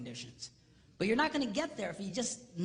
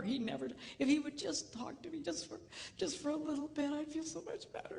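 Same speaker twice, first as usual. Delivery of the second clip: mimicked crying; 6 semitones higher, 5 dB quieter than usual. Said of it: "she's just crying".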